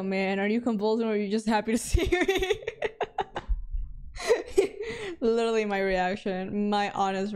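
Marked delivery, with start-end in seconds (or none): 2.7-5.2 long high-pitched cackle; helpless squealing laughter; cracking up, high and long; high giggling laugh. Real, not acted. Cracks up at window man.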